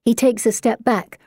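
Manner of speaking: plain reading voice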